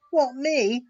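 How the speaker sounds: indignant snort, high and loud. A narrator's reading. Engine James balking at his driver's order, snorting in disbelief. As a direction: scoff in outrage at the idea. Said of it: "snorted James".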